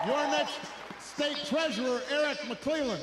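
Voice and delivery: rally speech. Real, not acted. Rally call for candidates.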